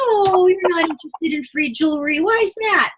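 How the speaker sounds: high, playful mock surprise; lightly hammed-up disbelief. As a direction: tease them, pitching it high. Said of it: "I'll be like".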